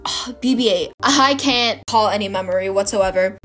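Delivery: ordinary talk, nothing marked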